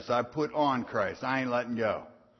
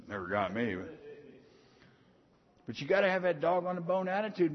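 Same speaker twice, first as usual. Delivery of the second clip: ordinary talk, nothing marked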